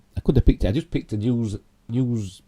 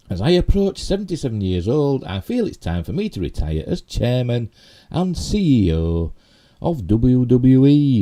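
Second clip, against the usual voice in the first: higher, measured reading of a statement; formal read-out with lifted pitch; statement read aloud; 6 semitones higher, 4 dB louder than usual. Real, not acted. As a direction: read the quote out in emphatic phrases. Reads quoted statement aloud.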